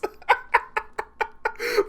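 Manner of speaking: high, airy laugh; breathless laugh. Real, not acted. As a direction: wheeze with laughter. Laughs at poking scene.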